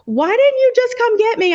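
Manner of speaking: high, lightly voiced puzzled husband; a hint of an exasperated husband; mock husband. Husband asks why she didn't come get him, mock puzzled.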